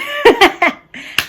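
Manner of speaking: high cackling laugh; high-pitched giggling burst; helpless laughter. Real, not acted. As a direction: let a real laughing fit spill out. Laughing fit continues.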